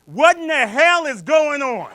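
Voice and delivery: loud, high, drawn-out holler; screaming heckler lady. Retold or imitated. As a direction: holler it slowly in total disbelief. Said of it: "and this lady screamed out".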